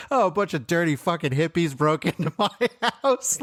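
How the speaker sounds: high-pitched retold grumble breaking into laughter; laughing mock rant. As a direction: crack up while quoting an indignant complaint. Retells Rick's rant, cracking up.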